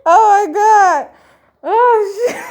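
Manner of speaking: loud laughing scream; shrieking jackpot winner. Real, not acted. Laughing scream after crack.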